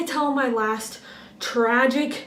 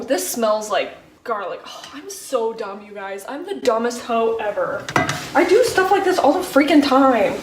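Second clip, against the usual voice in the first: usual speaking voice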